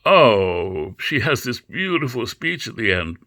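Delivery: mock being swept away by a beautiful speech; put-on rapturous admiration; gushing reader. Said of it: "if you read it and you think".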